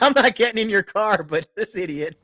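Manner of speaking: cracking up while voicing the wife; laughing paraphrase. Laughing retelling of wife's meaning.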